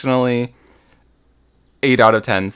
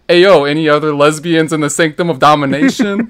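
quoting a friend; 5 semitones higher, 5 dB louder than usual. Retold or imitated. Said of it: "just saying".

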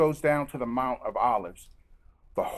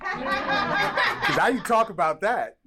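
laughing; 7 semitones higher, 3 dB louder, much slower than usual. Real, not acted. Laughing during aside.

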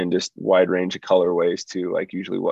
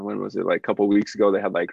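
normal talking voice

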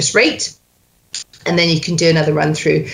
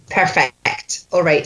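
normal talking voice

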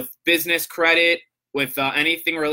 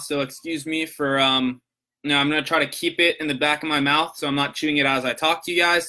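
ordinary talk

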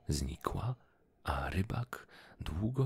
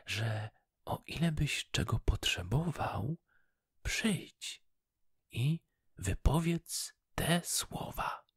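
goldfish voice; 9 semitones higher than usual. Acted. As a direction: remind him gently as the fish. Goldfish speaks again.

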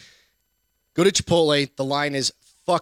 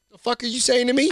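higher, indignant disbelief in a retold quote; incredulous retort; 8 semitones higher than usual. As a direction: challenge him, baffled. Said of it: "I was like".

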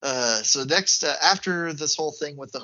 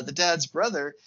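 ordinary delivery

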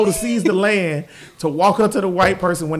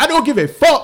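mock defiant quote; 6 semitones higher, 4 dB louder than usual.

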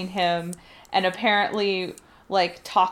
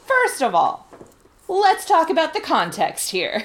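exasperated retort; 8 semitones higher, 5 dB louder than usual. Retold or imitated. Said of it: "And I was just like".

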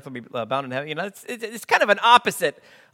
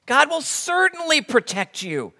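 pleading Peter; 7 semitones higher, slower than usual. Retold or imitated. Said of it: "Peter steps in".

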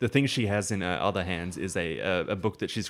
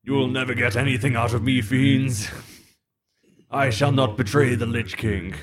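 proud, louder undead servant; defiant villain voice; 5 semitones higher, 7 dB louder than usual. Acted. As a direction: sneer it out as a captured villain. GM voices captured Lich King servant.

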